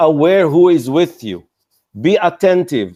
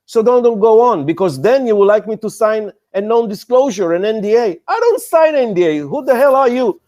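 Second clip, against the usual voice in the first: indignant refusal; 5 semitones higher than usual. Retold or imitated. Investor refusing to sign NDA.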